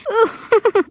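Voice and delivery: high-pitched aunt's giggle; high titter. Acted. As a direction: titter like a little bird. Aunt Smiley tittering in story.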